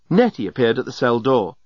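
usual speaking voice

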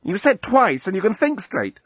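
high protesting woman's voice; objecting woman. Acted. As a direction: raise the voice to object. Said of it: "objected Lucy".

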